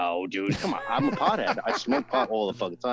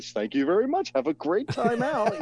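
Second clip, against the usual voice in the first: high, slow, sugary hotel politeness; fake-cheerful bellman sendoff; polite service voice; 10 semitones higher than usual. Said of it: "I have to be like".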